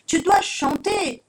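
excited plea; urgent cry. Acted. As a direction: urge him on. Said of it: "s'écria la poulette".

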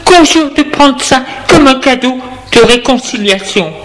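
high, anxious pleading; frightened. Acted. Jeanne offering money to her lover, frightened. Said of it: "effrayée".

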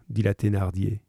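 usual speaking voice

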